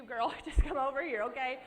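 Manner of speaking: playful laughing call to the crowd; laughing invite. Real, not acted. Inviting the audience to her praying mama, laughing and loud.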